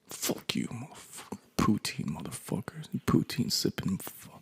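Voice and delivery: whispered, seething mock grudge; muttered cursing. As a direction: grumble insults under your breath. Acts muttered grudge against Canada.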